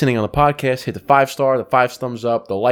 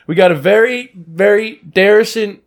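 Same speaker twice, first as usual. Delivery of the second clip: imitating a fumbling reporter; 6 semitones higher than usual. Recounting the reporter's blunder, high and stumbling. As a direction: mimic a reporter tripping on every word. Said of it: "when she goes".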